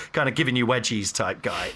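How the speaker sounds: ordinary delivery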